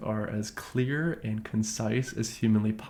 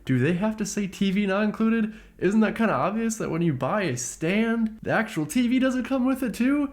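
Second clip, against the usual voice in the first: incredulous questions climbing higher and higher; exasperated rebuttal; 7 semitones higher than usual. Retold or imitated. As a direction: argue the obvious, pitch rising as you go. Exasperated rebuttal to buyer's demand.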